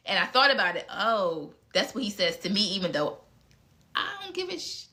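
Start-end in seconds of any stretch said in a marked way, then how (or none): 3.9-4.9 very high, soft little voice; high-pitched aside. Real, not acted. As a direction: squeeze it out high and small, cutting the swear short. Half-swallowed swear in an aside.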